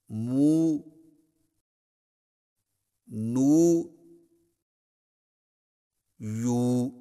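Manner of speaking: long clear oo vowels, each syllable alone; drill syllables. Shown as a demonstration. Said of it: "صحیح تلفظ کے ساتھ ادا کیجیے". (الگ الگ لمبی واو معروف کے ساتھ)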